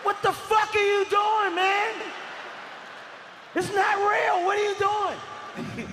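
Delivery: dad screaming at the other parents, high and outraged; outraged yell. Retold or imitated. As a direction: yell across the playground in outrage.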